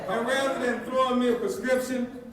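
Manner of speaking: high, loud, rousing delivery; impassioned preaching. Real, not acted. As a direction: drive the moral home to a cheering crowd. Speaker drives home the moral.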